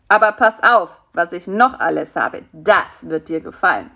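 ordinary delivery, nothing marked

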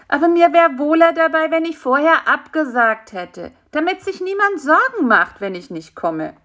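high fly voice, dropping at the end; high-pitched, concerned insect; worried little fly. A narrator's reading. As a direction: fret in a small high voice.

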